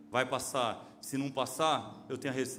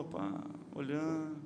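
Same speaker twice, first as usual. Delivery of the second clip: ordinary delivery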